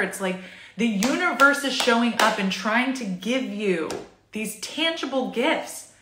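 usual speaking voice

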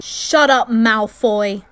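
loud, furious shut up; angry shout at Malfoy. Acted. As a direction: yell him down. Quarrel with Malfoy.

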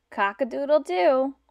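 ordinary talk, nothing marked

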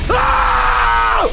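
sudden high loud scream; startled scream. Real, not acted. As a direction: scream at the jump scare. Jump scare.

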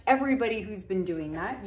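usual speaking voice

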